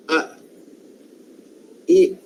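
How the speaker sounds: ordinary talk, nothing marked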